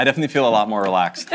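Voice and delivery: laughing. Real, not acted. Laughing joke.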